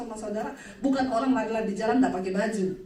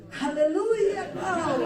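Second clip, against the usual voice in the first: slow drawn-out churchy rebuke; hammed-up pastor's fight; mock pious quarrel; 6 semitones higher, much slower than usual. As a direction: scold with holy words, very slowly. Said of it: "pasti berantemnya".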